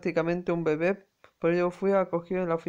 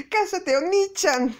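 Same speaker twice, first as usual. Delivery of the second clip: put-on much higher, squealing childish plea; little girl squeal; 13 semitones higher, 4 dB louder, slower than usual. Little sister squeals her marriage demand at her big brother. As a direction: shriek it like a tiny kid sister.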